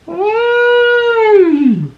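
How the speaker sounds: very high held trumpet hum; mock elephant sound; elephant call. Shown as a demonstration. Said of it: "That's a sound that elephants make".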